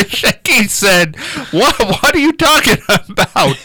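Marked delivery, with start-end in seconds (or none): 1.5-3.6 higher-pitched, stammering mock disbelief; baffled. Comedian answering a sudden threat, baffled. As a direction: play the bewildered guest, voice lifted.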